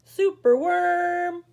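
put-on lively read-aloud for little kids; animated picture-book reading; story narration. A narrator's reading. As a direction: read it like story time on the rug. Reads picture book to children.

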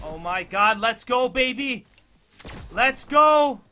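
high, loud yells of joy; ecstatic shouting. Real, not acted. Gamer screaming at his killstreak, ecstatic. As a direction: scream it out in triumph. Big kill streak celebration.

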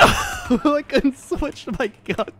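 high, loud, slow laughing complaint. Real, not acted. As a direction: laugh through the excuse. Laughing after dying.

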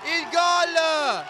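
goal celebration shout. Real, not acted. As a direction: scream the late winner with pure joy. Late winner, commentator erupts.

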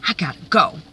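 usual speaking voice

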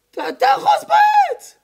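very high, loud, slow yell; desperate shout. Retold or imitated. A bystander yelling at the man to grab the tree, desperate shout. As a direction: scream the order to grab on.

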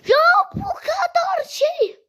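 high, slow whining complaint; drawn-out whine. Real, not acted. Gamer whining at the boss fight. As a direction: stretch it out in a whine. Exasperated at game difficulty.

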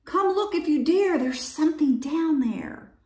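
high-pitched urging; calling others over. A narrator's reading. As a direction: beckon them over in a high voice. Badger calls the others over.

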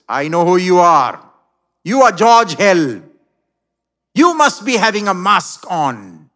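jilted woman unmasking George; loud accusation. Acted. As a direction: expose him with force.

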